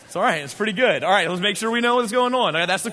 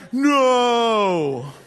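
mock groan no. Imitating a stadium fan's laughing groan. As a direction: moan it like a crushed fan. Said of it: "This guy was all like".

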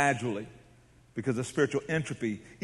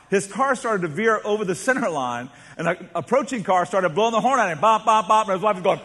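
ordinary talk

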